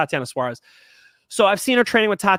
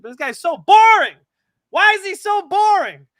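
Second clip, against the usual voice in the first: mock whine; 13 semitones higher, 8 dB louder than usual. Mocking the fighter, whining.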